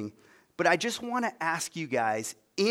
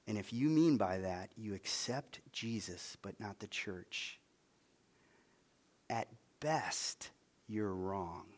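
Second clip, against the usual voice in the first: low, soft, grave delivery with long pauses; hushed, near whisper; 5 semitones lower, 8 dB quieter than usual. Real, not acted. Preacher telling churchgoers they may be wrong, hushed. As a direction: say it softly and weightily. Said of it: "I'll whisper this right here".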